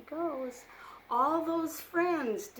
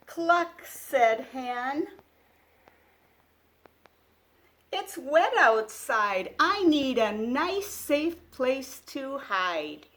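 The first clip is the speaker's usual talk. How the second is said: put-on louder, lively read-aloud for small children; picture book reading; 3 semitones higher, 9 dB louder, slower than usual. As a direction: read it like a bedtime storybook. Reads animal dialogue.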